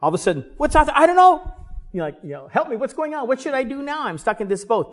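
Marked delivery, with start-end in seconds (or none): 0.6-1.5 loud, high-pitched panic; acted panicked scream. Disciples see something out on the water, acted panic. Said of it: "They screamed". 2.5-4.9 high mock cry for help; hammed-up panic, pitched high without much volume; acted panicked screaming. Disciples stuck in the boat cry out, acted panic. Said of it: "They screamed".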